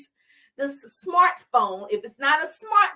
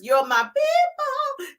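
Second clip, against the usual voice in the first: high sing-song; 13 semitones higher, slower than usual. Acted. Repeated rallying line.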